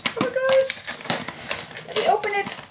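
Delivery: high excited squeal; shrieking jackpot winner. Real, not acted. Repeated exclamation, higher take.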